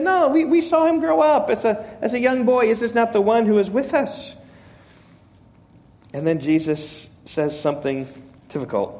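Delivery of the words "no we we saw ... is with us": higher-pitched voicing of sceptical objectors; mild acted disbelief. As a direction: object in a raised pitch, lightly playing a doubter.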